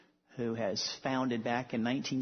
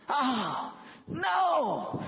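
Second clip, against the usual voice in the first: very high, louder wail of dismay; exasperated patient; 13 semitones higher, 3 dB louder than usual. Retold or imitated. Patient hearing the wrong answer, high exasperated wail. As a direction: cry out in disappointment. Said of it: "And he'd walk off".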